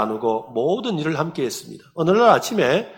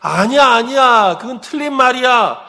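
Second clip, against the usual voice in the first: imitated head-shaking refusal, raised voice; grandmother's emphatic denial; 10 semitones higher, 7 dB louder than usual. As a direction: protest firmly as the old woman. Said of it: "고개를 막 좌우로 흔들면서".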